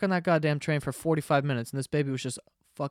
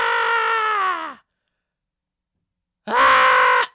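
imitated baby scream; 12 semitones higher, 9 dB louder than usual. Imitating the baby screaming on the stuck train, loud mock wail. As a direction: scream like a wailing infant. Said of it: "a baby just fucking screaming".

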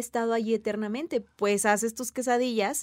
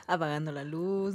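softer, lower mock eerie voice; hushed spooky tone; 5 semitones lower, 4 dB quieter than usual. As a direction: murmur it like a horror host. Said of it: "estoy así como de que entrando a la noche".

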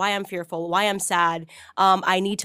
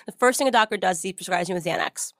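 ordinary talk, nothing marked

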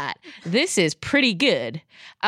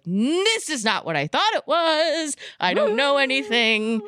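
mock bewilderment; 8 semitones higher than usual. Viewer retelling a plot surprise, bewildered exclamation. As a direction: exclaim it high, as if everything you knew collapsed. Retells being shocked by the show.

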